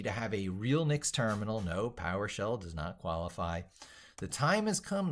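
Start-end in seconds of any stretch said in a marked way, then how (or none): 4.2-5.1 carpenter quote. Retold or imitated. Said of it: "the carpenter said".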